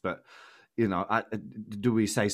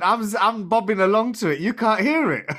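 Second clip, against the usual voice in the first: high-pitched words through laughter; laughing; 11 semitones higher, 6 dB louder than usual. Real, not acted. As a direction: laugh while you talk. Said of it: "I'm laughing".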